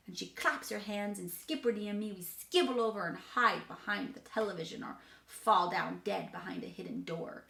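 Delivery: ordinary delivery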